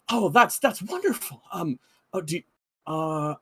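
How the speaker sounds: a touch of eager surprise; pleasantly surprised. Acted. Camp guard delighted the mercenaries came to help. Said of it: "he kind of gets up".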